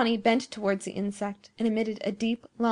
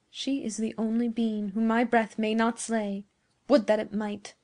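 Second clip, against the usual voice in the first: usual speaking voice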